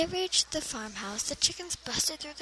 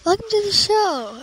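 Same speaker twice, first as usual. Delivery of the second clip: excited announcer; 8 semitones higher, 12 dB louder than usual. Acted. Host opening the show.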